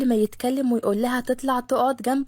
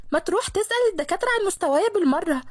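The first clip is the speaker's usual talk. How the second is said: fast, higher sarcastic comeback; snappy retort; 6 semitones higher, faster than usual. Acted. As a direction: shoot it back quickly. Manar snaps back. (منار بترد بسرعة وتريقة)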